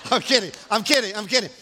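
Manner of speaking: ordinary talk, nothing marked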